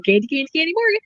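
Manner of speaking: lilting mock chant of the name; husband mimicking the streamer's name in a playful lilt; teasing singsong. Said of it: "He'll go".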